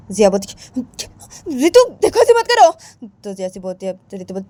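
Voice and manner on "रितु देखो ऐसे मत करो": high, loud cry to stop; loud high plea. Acted. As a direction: call out to stop her, pitched up. Jiya protests again.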